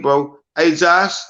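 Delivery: normal talking voice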